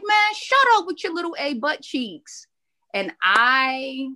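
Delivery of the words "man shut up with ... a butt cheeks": mock insult. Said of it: "He like".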